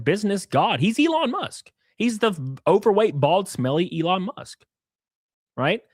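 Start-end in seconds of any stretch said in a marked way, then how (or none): none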